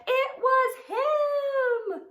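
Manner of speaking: very high, loud, drawn-out accusation; sneaky pug accusing. Acted. Pig points at Trevor with a very high, loud, slow accusation. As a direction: stretch out the blame gleefully.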